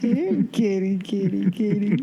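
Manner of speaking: insistent, loud cat call. Real, not acted. Calling a cat.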